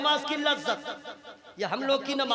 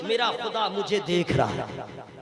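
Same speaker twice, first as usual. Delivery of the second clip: low, hushed conviction; 7 semitones lower than usual. Shown as a demonstration. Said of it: "ये ईमान रखिए".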